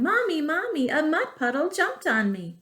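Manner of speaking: put-on high child's call for mommy; yelling. A narrator's reading. Muddy girl yelling to her mother indoors. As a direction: cry out high for mom.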